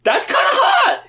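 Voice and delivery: high teasing approval. Real, not acted. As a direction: say it with cheeky grin.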